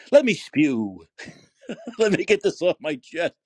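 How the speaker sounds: loud, low, laughing interjection; laughing aside. Real, not acted. Host asking a waiting caller for patience, laughing. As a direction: break into a laugh while asking to vent.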